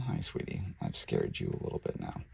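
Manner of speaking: soft, low whispered reassurance to a frightened pet; soothing whisper. Real, not acted. Keeper soothing the snake she scared, soft whisper. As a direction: whisper gently to calm it.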